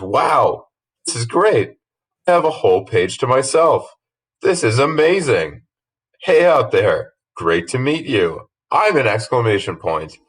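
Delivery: high, bubbly and thrilled; excited exclamation mark voice. Acted. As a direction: gush like an overjoyed punctuation mark. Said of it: "that's how exclamation marks talk".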